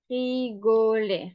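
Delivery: "rigoler" spread out syllable by syllable; slow dictation. Shown as a demonstration. Teacher dictating "rigoler" to learners, slow measured delivery. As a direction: say "rigoler" slowly for someone writing. Dictates word slowly, then repeats normally.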